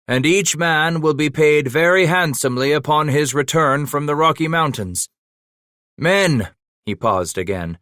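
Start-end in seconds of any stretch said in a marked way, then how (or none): 0.1-6.5 louder and higher, projected to the men; calling over the crowd. A narrator's reading. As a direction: promise the reward to the whole throng. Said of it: "the voice carried over the throng".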